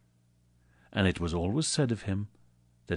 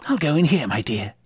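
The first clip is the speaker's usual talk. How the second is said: Scrooge, eager; 7 semitones higher, 5 dB louder than usual. Acted. Scrooge at dining room door.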